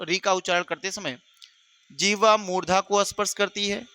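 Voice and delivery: teacher dictating notes word by word; dictation. Shown as a demonstration.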